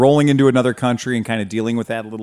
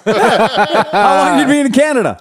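laughing quote; 12 semitones higher, 4 dB louder, slower than usual. Retold or imitated.

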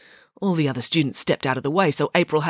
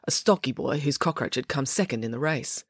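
ordinary delivery

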